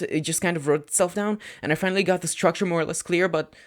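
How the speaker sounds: put-on high, rushing, apologetic boy; anxious rambling teen. Student babbling apologies to Aizawa-sensei, high, fast and panicked. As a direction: spill it all out in one panicked breath.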